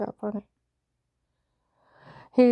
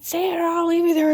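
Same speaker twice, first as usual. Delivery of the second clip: high, loud mimicked shout; shouted threat; 9 semitones higher, 8 dB louder than usual. Man threatening to leave her there, shouted threat. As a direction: yell the ultimatum at a high pitch.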